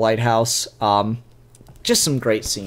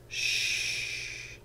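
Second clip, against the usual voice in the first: a soft unvoiced shh; whispered shh to calm him; hushing whisper; 15 dB quieter than usual. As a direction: hush him gently in a whisper. [name] hushing the man.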